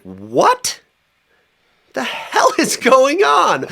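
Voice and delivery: high, drawn-out, bewildered cry; stunned disbelief. Retold or imitated. Tripping man baffled by the vision, high and slow. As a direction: stretch it out in total disbelief.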